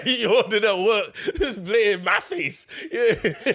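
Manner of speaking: high, laughing through words; can barely talk for laughing; laughing hard. Real, not acted. Laughing fit while talking.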